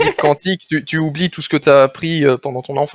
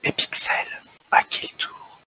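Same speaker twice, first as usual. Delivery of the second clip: whispering; 12 dB quieter than usual. Acted. Ad tagline whispered.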